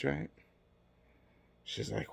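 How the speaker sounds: ordinary talk, nothing marked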